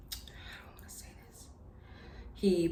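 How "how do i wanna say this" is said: whispered to herself while searching for words; hushed hesitant aside. Real, not acted. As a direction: murmur it privately, unsure how to go on. Searching for words mid-story.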